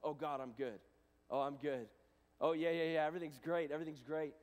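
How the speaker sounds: forced upbeat denial; fake cheerful. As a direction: brush God off with forced cheer. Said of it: "what I probably would say isn't the truth".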